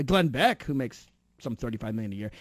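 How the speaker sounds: usual speaking voice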